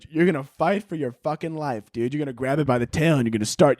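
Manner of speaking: ordinary talk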